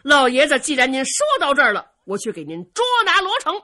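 loud, high, fast vow; foot-stamping resolve. A narrator's reading. Dongfang Bo vowing to capture Luo Cheng, foot-stamping resolve. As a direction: burst out with a forceful pledge. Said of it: "东方伯一跺脚啊".

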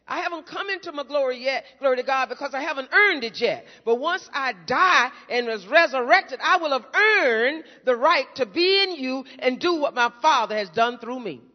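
loud, driving sermon delivery; building pulpit fervor; fervent preaching. Acted. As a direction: preach it out to the congregation. Preaching at full force.

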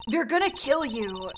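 normal talking voice

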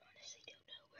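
tense high whisper; tense searching whisper. Real, not acted. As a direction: whisper it, worried. Hunting the last player.

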